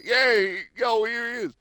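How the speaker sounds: high, fast New York phone talk; New York guy. Retold or imitated. Guy passing the phone to Dead Man, New York voice. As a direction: rush the handoff. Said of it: "It's like".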